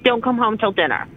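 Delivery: ordinary talk, nothing marked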